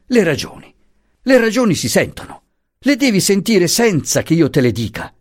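high-pitched, impassioned argument; exasperated marital protest; heated insistence. Acted. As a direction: insist urgently, pitch well up. Acted quarrel, wife insisting.

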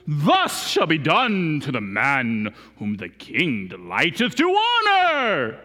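slow, grand, lordly announcement; proud proclamation. Retold or imitated. Haman imagining himself honoured, proudly proclaiming. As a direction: savour every word like a triumph. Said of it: "he thought it would be like this".

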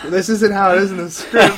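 loud, high mock complaint breaking into laughter; laughing protest. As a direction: protest while cracking up at the absurdity. Retells beaten hero protesting, laughing.

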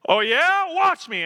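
loud, high-pitched defiant outburst. Acted. Government man defying the farmer, loud and heated. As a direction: throw it back as a loud dare.

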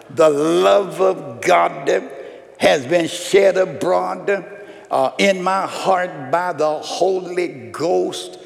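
loud, high pulpit declaration; full-force preaching. Acted. Pastor proclaiming love for everybody to the congregation. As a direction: preach it out to the church.

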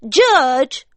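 high, loud call to the judge. Acted. Wife calling out to judge.

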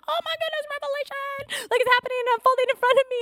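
mock panic, pitch leaping up; panicked. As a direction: relive the alarm, fast and high. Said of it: "I got a little panicked".

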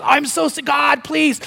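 high-pitched hammed-up pleading; mock desperate prayer. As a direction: plead with God, theatrically desperate. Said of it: "you're praying like crazy".

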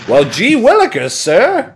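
high, loud gee-whiz voice; hokey old comic voice. Retold or imitated. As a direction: play an eager old-timey hero. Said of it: "It has some".

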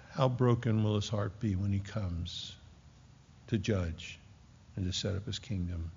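soft, halting reflection with long pauses; hushed sorrow. Real, not acted. Preacher grieving over Christ's broken heart.

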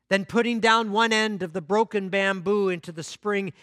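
lively storytelling voice acting out each character; story narration. Pastor telling the congregation a parable, animated storytelling. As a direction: tell the parable like a bedtime story, drawing the congregation in.

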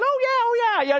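high sarcastic oh yeah; mock retort. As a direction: fire back, hammed up. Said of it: "And they're thinking".

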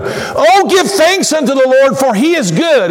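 high, heartfelt exclamation; fervent praise, opening on a high "oh"; emphatic praise. Shown as a demonstration. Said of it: "That's emphasis is what that is".

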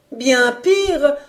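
high, emphatic cry of worse news; alarmed exclamation. A narrator's reading. Cook topping the guess of catastrophe, exclaiming. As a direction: exclaim it, pitched well up. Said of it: "s'exclama l'excellente femme".

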